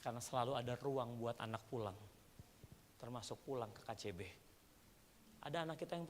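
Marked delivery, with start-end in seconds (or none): none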